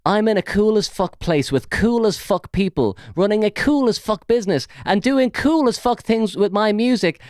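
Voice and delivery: high, sneering mock of a boastful post; mocking a smug post; mocking read-out. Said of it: "reading his post mockingly".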